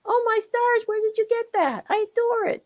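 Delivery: high, loud fanboy squeal; excited squeal. Acted. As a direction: gush with delight over the costume. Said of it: "Blitzen squealed".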